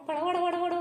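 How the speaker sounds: rapid clattering "pada pada"; repeated thud-thud syllables; imitated falling sound. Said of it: "താഴെ വീഴുന്നുണ്ടായിരുന്നു phone".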